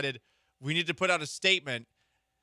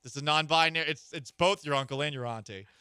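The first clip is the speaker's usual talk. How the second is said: ordinary delivery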